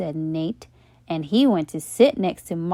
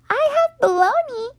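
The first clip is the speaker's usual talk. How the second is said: put-on much higher girl's voice; Leslie's voice; 3 dB louder than usual. A narrator's reading. Leslie telling the class her lunch, chirpy.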